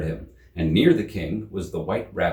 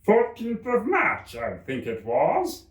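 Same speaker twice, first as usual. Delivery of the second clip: the Hatter; 12 semitones higher, slower than usual. A narrator's reading. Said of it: "he said".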